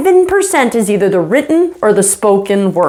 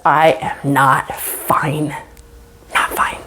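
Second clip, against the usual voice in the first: slow, soft, half-whispered words; hushed confession; 6 semitones lower, 5 dB quieter, slower than usual. Acted. Body language secretly says not fine, hushed and slow. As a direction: let each word out slowly under the breath.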